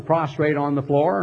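ordinary talk, nothing marked

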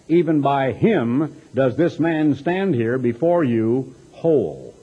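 normal talking voice

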